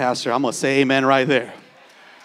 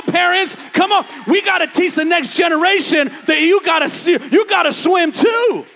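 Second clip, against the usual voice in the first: high, loud, building sermon cry; preaching at full force; 10 semitones higher, 8 dB louder than usual. Acted. Pastor rallying the congregation, high and loud preaching. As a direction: shout it to the rafters like a revival.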